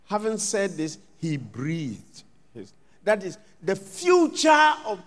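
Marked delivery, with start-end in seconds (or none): 1.2-2.7 slow, much softer trailing off; fading breath. Acted. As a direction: let the words die away. Jesus dying.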